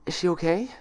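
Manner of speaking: high, worried plea; anxious question. Acted. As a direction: ask in sudden fear for a friend. Twilight anxious about friend.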